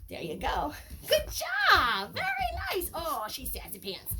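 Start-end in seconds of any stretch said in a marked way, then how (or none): 1.1-2.9 high, loud praise for the puppy; excited praise. Real, not acted. Trainer praising her puppy, excited praise. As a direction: reward her with your voice.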